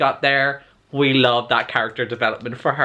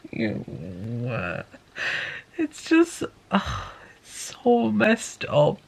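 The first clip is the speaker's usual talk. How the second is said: much higher, slow, squirming disgust; 3 semitones higher, 4 dB quieter, slower than usual. Real, not acted. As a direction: let the revulsion pitch the voice way up. Disgust at imprinting.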